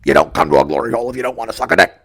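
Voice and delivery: low, loud, fast rough-guy voice; Mike character voice. Acted. Performing the favorite story for mom over FaceTime, deep character voice. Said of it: "I do the voice".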